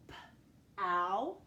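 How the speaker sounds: "P-ow" in two sounds with a gap; sounding out. Shown as a demonstration. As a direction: puff the p, pause, stretch ow in "P-ow". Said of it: "two sounds in that first syllable".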